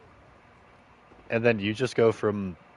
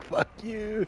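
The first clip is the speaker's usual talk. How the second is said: mock rude brush-off; 13 semitones higher than usual. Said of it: "He'd be like".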